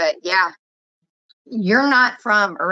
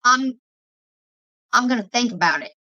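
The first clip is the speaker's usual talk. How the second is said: put-on higher-pitched take on an East Coast accent; imitated East Coast accent; 5 semitones higher than usual. Showing friend how they phrase it, imitated East Coast accent. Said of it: "You'll say".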